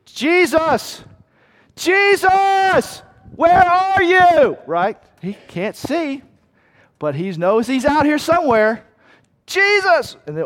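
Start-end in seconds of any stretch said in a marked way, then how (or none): 0.1-4.6 high, loud blind man's searching shout; crying out. Acted. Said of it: "I think it was more like this". 9.4-10.2 high, loud blind man's searching shout; crying out. Acted. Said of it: "I think it was more like this".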